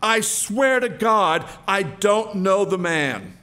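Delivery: heavy, insistent disavowal; emphatic denial. Acted. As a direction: swear it forcefully, word by word. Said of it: "heard himself say emphatically".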